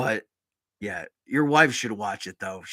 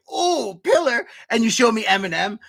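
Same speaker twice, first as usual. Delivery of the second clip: much higher, squealed awe; awestruck; 10 semitones higher, 5 dB louder than usual. Retold or imitated. Young self awed by the band Pillar, squeal of awe. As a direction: gush like a kid hearing it first. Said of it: "I was like".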